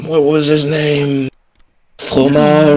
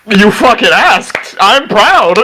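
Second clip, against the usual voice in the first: higher, hammed-up congratulation; mock proud cheering; 7 semitones higher, slower than usual. Fear applauding a companion for asking, playfully proud. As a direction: cheer your friend on with playful pride. Character applauding a friend who asked.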